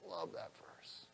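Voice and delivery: soft breathy whisper to himself; whispered aside. Real, not acted. Aside after the scripture.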